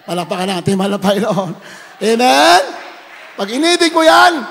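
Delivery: loud, rising preacher's call ending in a shouted Hallelujah; rally the church to get back up; preaching at full force. Acted. Preacher urging sinners to rise, full-force preaching.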